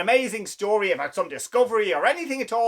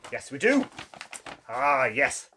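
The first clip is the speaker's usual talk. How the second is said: usual speaking voice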